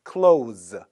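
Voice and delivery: clothes as a single falling "klohz"; th left silent. Shown as a demonstration. Teacher modeling native pronunciation, clothes without th. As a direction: drop the th in clothes, end on z. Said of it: "이렇게 발음합니다".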